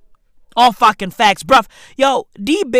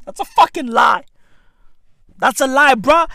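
ordinary delivery

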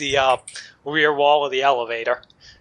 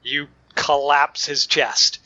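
usual speaking voice

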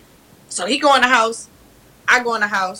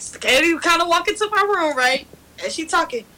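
ordinary delivery

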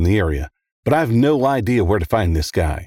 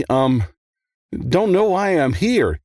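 hesitant, higher and slow; eked out; 8 semitones higher, slower than usual. A narrator's reading. Nervous kid questioned by a detective, words eked out. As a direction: squeeze it out reluctantly after a pause. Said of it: "he eked out".

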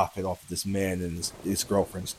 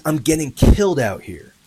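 raised, higher voice reporting a scream; mild imitated scream, louder and higher; retold scream; 4 semitones higher, 6 dB louder than usual. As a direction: retell a desperate scream without fully screaming. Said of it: "And then he screams".

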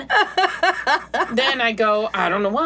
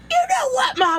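put-on drunk yelling. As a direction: holler it at your mom, tipsy. Drunk railing at mom, shout.

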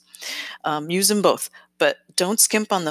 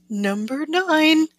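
sing-songy; 6 semitones higher than usual. Real, not acted. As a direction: stretch it out in a singsong. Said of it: "I'm getting a little sing-songy".

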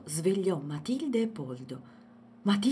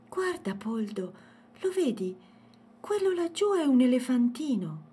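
high, louder burst of discovery; excited child; 7 semitones higher, 6 dB louder than usual. A narrator's reading. Girl shows Poldo the little elephant, excited. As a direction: point it out eagerly, building in volume.

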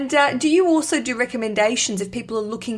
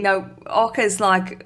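ordinary delivery

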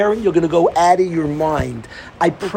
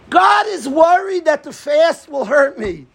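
emphatic shout; 9 semitones higher than usual. Real, not acted. Preacher exclaims God's loving worry.

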